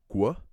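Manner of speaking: "gwa" as one isolated loud syllable; sound demonstration. Shown as a demonstration. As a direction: sound out "gwa" with a clear "gw" glide. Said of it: "the sound".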